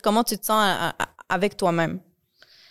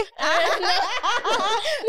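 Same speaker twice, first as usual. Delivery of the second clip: high-pitched giggling; laugh; 13 semitones higher than usual. Real, not acted. Gêne à la demande d'accent. (rire aigu)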